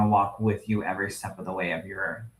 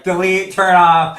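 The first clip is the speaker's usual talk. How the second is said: loud, high mock panic; mock alarmed command; 7 semitones higher, 10 dB louder than usual. Imitating a friend reacting to the question, loud mock command. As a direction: call it out in comic alarm.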